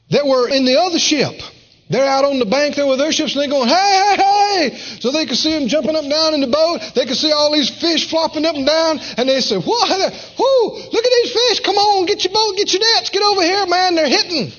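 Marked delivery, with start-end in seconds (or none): 3.7-4.8 high excited hailing; calling out. Acted. Fishermen hailing partners on the bank: high, excited calling out. 9.6-14.5 much higher, thrilled hollering; excited shouting. Acted. Fishermen calling partners to bring their boat: thrilled, high-pitched hollering.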